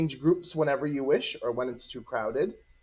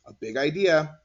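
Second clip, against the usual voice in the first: usual speaking voice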